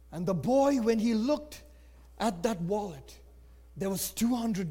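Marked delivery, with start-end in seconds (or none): none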